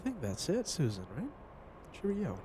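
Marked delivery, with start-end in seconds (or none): 1.9-2.4 mock British cheerio. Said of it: "That's how the British say hi".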